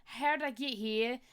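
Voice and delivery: playful mock Australian accent, a bit British; lightly done Aussie accent drifting British. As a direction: put on a hammed-up Australian accent. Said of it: "what on earth is with this accent".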